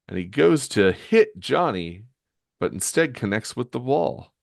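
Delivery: ordinary delivery, nothing marked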